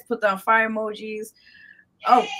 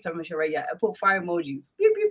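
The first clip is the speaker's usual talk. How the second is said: ordinary delivery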